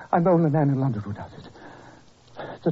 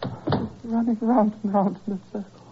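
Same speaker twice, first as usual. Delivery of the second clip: feverish raving; 6 semitones higher than usual. Acted. Delirious patient raving.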